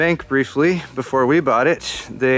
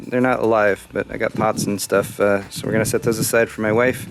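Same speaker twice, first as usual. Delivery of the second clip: ordinary talk, nothing marked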